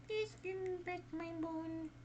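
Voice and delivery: very high, soft little ghost voice; small ghostly voice. Acted. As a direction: plead like a tiny spirit from the grave, high and soft. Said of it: "she heard a small voice".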